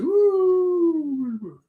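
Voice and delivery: high, long falling "woo"; imitated explosion noise. Host asking co-host about an explosion, imitating its sound. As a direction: mimic a far-off blast with a drawn-out high whoop. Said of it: "What is that explosion I hear?".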